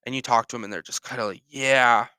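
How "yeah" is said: loud pained "yeah" with a heavy sigh; pained grunting. Acted. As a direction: act worn out and aching. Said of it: "look like they've been in pain".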